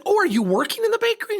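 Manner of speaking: high, fast, put-on sweet surprise; mock surprise. Faking surprise to the bakery clerk, high and sugary.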